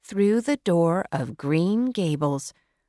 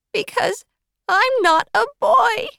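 put-on tearful girl; 6 dB louder than usual. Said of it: "She started crying very hard".

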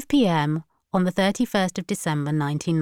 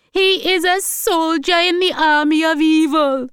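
high, loud grandmother outburst; acted shout; 11 semitones higher, 7 dB louder than usual. A narrator's reading. Grandmother shouting down the phone about evil, high and loud. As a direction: shout it as a denunciation. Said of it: "she heard Hortense shout".

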